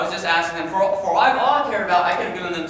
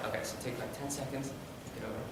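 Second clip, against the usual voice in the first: quiet aside; 5 semitones lower, 18 dB quieter, faster than usual. Real, not acted. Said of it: "aside off mic".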